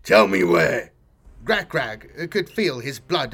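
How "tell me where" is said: loud orc roar, dropping low on where; furious cut-off. Acted. War boss cuts off the grot.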